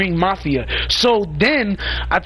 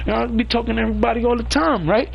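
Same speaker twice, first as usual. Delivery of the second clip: quick boastful quote; 5 semitones higher, faster than usual. Retold or imitated. Quoting boastful crew leader.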